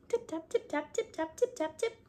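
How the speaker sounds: high, soft squeaky mouse voice; little tip taps squeaked high and soft; tiny mouse tapping. A narrator's reading. Narrator voicing mice tapping under the teacups, high and soft.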